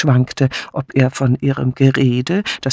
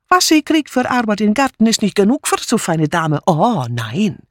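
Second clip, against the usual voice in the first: higher, jumpy, insistent broken German; agitated refugee maid; 3 semitones higher than usual. Acted. Mitzi insisting to the inspector, agitated and defiant. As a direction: pour out the accusation, wounded. Mitzi accuses Mrs Hames.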